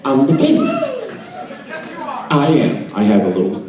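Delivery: ordinary talk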